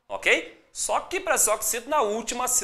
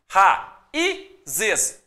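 "raízes" in three separate syllables, loud í; sílaba por sílaba; 4 dB louder than usual. Shown as a demonstration. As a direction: break "raízes" apart, ra, í, zes. Teacher splits word into syllables. ("raízes" silabado, com pausas)

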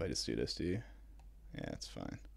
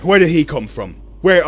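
loud; 8 semitones higher, 14 dB louder than usual. Real, not acted. Ambushed in game.

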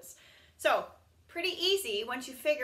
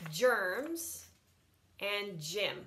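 ordinary talk

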